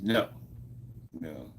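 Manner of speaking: ordinary talk, nothing marked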